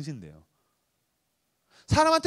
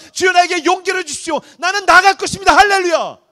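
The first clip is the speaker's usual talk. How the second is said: building to triumphant praise; 5 semitones higher, 5 dB louder than usual. Acted. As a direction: swell from trust into a victory cry. Prayer building to bold praise. (신뢰에서 승리의 외침으로 고조되는 기도)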